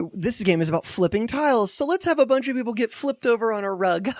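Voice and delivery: high, playful cutesy pitch. Acted. Host voicing the commercial about flipping tiles, cutesy. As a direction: climb into a cute, giggly sales voice. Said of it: "here's this cutesy".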